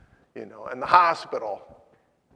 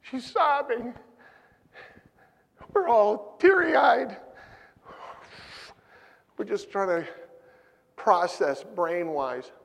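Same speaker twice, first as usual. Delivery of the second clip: emotional outcry; 9 semitones higher, 8 dB louder than usual. Retold or imitated. Preacher reliving the grief.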